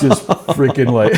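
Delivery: real laugh breaking through the words, slowed; chuckling while recalling the frightened recruits. Real, not acted. Recalling his stunned platoon to the interviewer, laughing.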